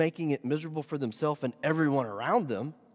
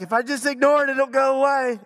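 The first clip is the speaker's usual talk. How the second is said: mock wishful thinking; 7 semitones higher, 8 dB louder than usual. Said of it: "close your eyes and be like".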